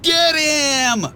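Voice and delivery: high witch voice calling the charge; witch's command. A narrator's reading. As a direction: screech the order to swoop. Witches attack Shrek.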